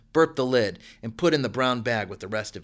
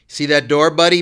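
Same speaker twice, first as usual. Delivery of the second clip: loud threatening snarl; 5 semitones higher, 13 dB louder than usual. A narrator's reading. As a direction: snarl it right in his face, much louder. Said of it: "Mickey snarled".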